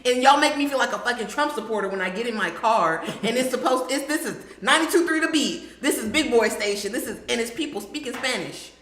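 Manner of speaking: normal talking voice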